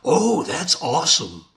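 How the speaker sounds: typical reaction. Retold or imitated. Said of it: "What do nine out of ten people say?".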